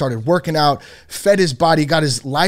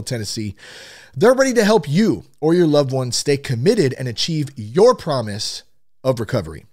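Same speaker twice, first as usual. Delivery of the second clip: usual speaking voice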